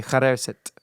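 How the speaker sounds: put-on South African accent. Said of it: "very Victorian South African accent".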